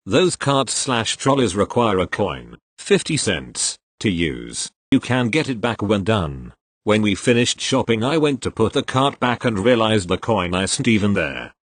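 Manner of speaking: ordinary delivery, nothing marked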